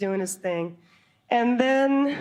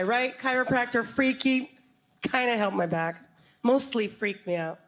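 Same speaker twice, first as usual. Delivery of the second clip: ordinary talk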